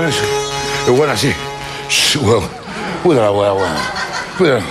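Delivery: usual speaking voice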